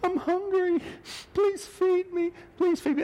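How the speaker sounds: very high, squeaky begging; puppy dog pleading. Acted. Hungry puppy working on your compassion, squeaky pleading. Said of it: "then it gets the puppy dog going".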